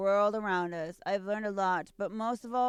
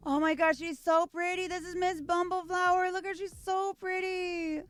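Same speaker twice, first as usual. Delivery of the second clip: much higher, squealing over the art; gushing; 11 semitones higher than usual. Real, not acted. A collector gushes over the Miss Bumbleflower card, squealing high. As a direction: coo over how pretty she is.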